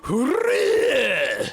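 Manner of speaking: high, loud mock war cry; imitating battle cry. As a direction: belt out a tough-guy battle yell. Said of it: "like Japanese guys going".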